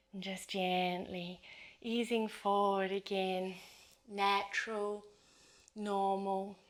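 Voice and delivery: soft, fading meditation voice; guided meditation. Acted. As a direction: ease them down into stillness. Quiet closing cues.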